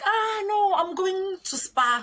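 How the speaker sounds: high, drawn-out, singsong reply; slow high-pitched mimicry of a neighbour; imitated carefree neighbour. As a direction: answer airily, way up in pitch. Neighbour airily answering, acted.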